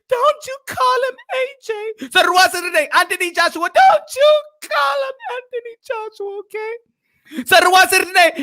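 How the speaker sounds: high, crying and shouting; mock wife voice. Wife naming her lover, in a high wailing shout.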